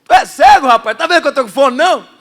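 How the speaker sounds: loud, high indignant comeback; mock retort. Pastor retelling his comeback to the stranger, loud mock indignation. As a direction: snap back playfully. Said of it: "Eu falei".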